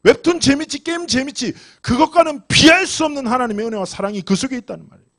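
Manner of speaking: high, forceful sermon climax; urgent preacher's exhortation, pitched high and insistent; impassioned preaching. Acted. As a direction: drive it home to the congregation.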